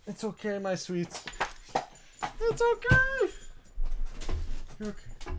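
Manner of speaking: very soft, tender reassurance; soothing. Real, not acted. Comforting the freaking-out puppy, soft and tender. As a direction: coo to settle a scared pet.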